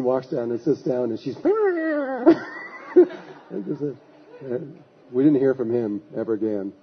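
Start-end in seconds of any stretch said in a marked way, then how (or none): 1.5-3.6 high, comic mock-wailing noise; imitated fuming noise. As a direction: mimic a wordless high-pitched fuss. Imitates girlfriend's reaction after sitting down.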